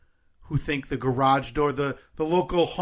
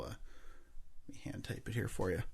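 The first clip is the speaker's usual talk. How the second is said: muttered; 6 semitones lower, 17 dB quieter than usual. Real, not acted. Muttering while typing.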